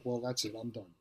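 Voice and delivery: resigned inner thought. Retold or imitated. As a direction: let it die away in defeat. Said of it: "I'm like".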